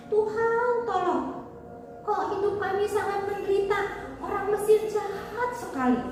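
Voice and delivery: high, pleading lament; acted crying out. Suffering Israelites crying to God for help, acted plea. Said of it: "Mereka teriak kepada Tuhan".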